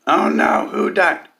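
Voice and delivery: high, loud mock-concerned oh no; mock worried commenter. Mocking the first commenter on the grief post, high and loud. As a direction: ham up the alarmed commenter. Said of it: "first comment says".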